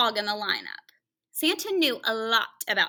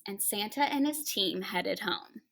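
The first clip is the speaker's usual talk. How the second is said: soft whisper; 3 semitones lower, 6 dB quieter than usual. A narrator's reading. Narrator whispers ending.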